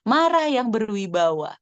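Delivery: usual speaking voice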